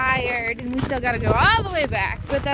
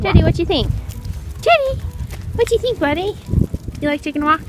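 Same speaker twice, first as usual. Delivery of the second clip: talking to a dog; 4 semitones higher, 4 dB louder, faster than usual. Real, not acted.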